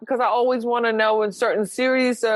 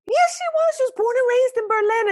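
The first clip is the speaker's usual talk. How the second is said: insistent; 12 semitones higher than usual. Real, not acted. Insists against a doubt.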